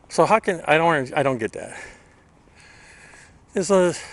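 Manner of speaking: heavy breathing from the heat; puffing while ranting. Real, not acted. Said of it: "the reason I'm breathing like this is because it's quite hot".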